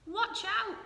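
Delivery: high, loud warning cry; sudden cry. A narrator's reading. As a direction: warn them sharply. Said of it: "cried Bee suddenly".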